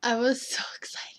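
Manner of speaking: whisper. Acted. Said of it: "whispering along".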